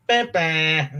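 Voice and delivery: "bah, bah" as two short horn blasts; imitated two-tone horn. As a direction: mimic a British train horn. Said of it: "they usually have a two-toned horn. They go".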